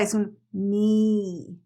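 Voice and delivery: mi with a long, smiling ee sound. Shown as a demonstration. Teacher demonstrating a long smiling I. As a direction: stretch the I in mi wide and long. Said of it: "Y sonríete y haz la I larga".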